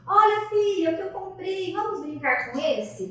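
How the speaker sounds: high, loud coaxing to a daughter; coaxing mother. Shown as a demonstration. Mother showing her daughter a new toy car, coaxing. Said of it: "cheguei pra ela pra brincar".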